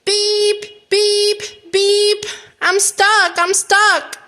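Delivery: high beeps and calls of distress; acted high-pitched alarm; little truck's cry. A narrator's reading. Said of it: "cried the little blue truck".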